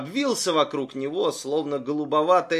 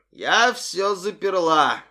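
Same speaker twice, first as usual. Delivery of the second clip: put-on drunk and pleased; 4 semitones higher, 6 dB louder, slower than usual. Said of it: "пьяная улыбка".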